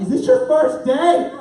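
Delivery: high, loud, drawn-out exasperation; incredulous jab at a clueless worker; frustrated. Retold or imitated. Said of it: "kinda frustrated".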